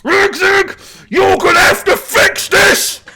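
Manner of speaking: high, loud furious scream. Acted. Warlord screaming at Rigzig, furious scream. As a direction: scream at the shaman over the broken throne. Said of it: "he screams".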